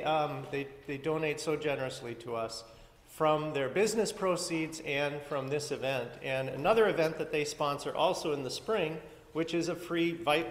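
ordinary delivery

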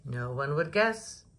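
ordinary delivery, nothing marked

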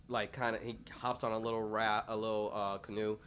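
ordinary delivery

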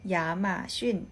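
"หยาหม่าชิ่น" said as Mandarin ya-ma-xun; "หยาหม่าชิ่น" in Chinese tones; Mandarin reading. Shown as a demonstration. Teacher giving the Chinese name for Amazon, Mandarin tones. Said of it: "Amazon อ่านว่า".

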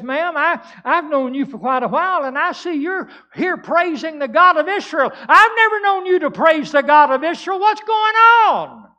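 mounting preacher's shout; loud incredulous questioning. Acted. Preacher voices excited reporter questioning worshiper.

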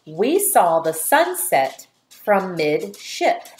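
slow, clear dictation voice, every word separate. Shown as a demonstration. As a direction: read it slowly for the children to write. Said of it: "your next sentence is".

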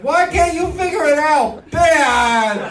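high, whiny mock Greta plea; a high, pleading child voice; mock crying teen. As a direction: whine it like a crying kid. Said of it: "She's like".

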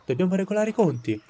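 excited villager. Acted. Angry villager in the crowd.

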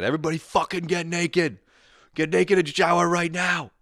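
put-on high, loud barked orders of an old coach; mock gym coach. Old coach orders boys to strip and shower, high loud bark. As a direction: drill the boys like a strict coach.

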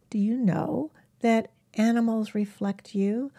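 ordinary delivery

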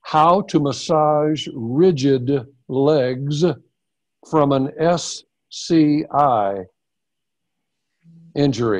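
slow, deliberate repeat; slow and clear. Shown as a demonstration. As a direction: spell it out slowly for her. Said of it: "So what was the first part of the question?".

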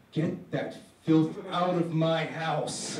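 slow, low stern father; dad ordering filth out, slow and stern. Acted. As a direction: growl it out like a cold dad. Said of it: "there stands my dad".

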